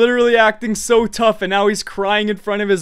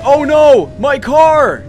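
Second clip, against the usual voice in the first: mock wail, high and loud; dismayed cry; 5 semitones higher, 6 dB louder, slower than usual. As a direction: imitate a friend crying out over a wrecked car. Retells friend's dismay at car.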